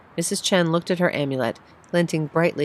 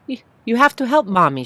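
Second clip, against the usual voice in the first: higher, louder, faltering; stammering plea; 8 semitones higher, 6 dB louder than usual. Acted. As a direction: plead with him, shaken. Mother pleading to son.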